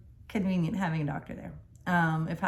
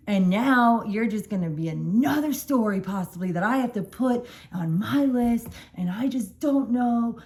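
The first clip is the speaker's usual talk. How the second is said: higher, pleading-angry retelling, slowing down; imitating the furious doctor, mildly raised; retold upset doctor; 5 semitones higher, 4 dB louder than usual. Doctor berating the couple over risk, upset retold rant. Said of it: "really, really upset".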